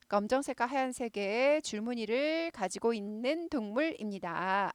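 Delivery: usual speaking voice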